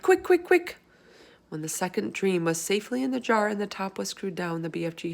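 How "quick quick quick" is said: high, loud hurried calls; urgent cry. Acted. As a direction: squeal it out in a rush. BFG urgently calling out.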